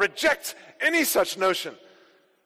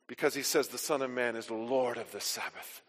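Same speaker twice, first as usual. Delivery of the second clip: usual speaking voice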